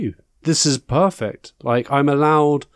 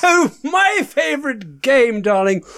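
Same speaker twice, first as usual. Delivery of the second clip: campy drag queen; 5 semitones higher than usual. Acted. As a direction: gush every word like a glamorous diva. Host character, campy darling persona.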